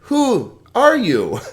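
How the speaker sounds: high, slow, drawn-out question; insistent questioning. Acted. Pharisees questioning John the Baptist, high insistent mock demand. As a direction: stretch each word in a mock demand.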